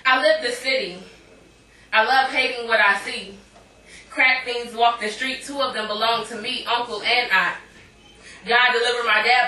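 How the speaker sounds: spoken word delivery; poem. Acted. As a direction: perform it like slam poetry. Performs poem.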